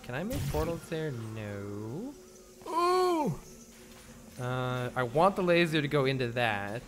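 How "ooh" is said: high ooh. Real, not acted. Sees something.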